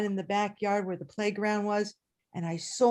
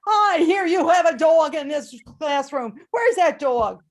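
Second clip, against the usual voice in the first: demanding principal; 4 semitones higher, 7 dB louder than usual. Retold or imitated.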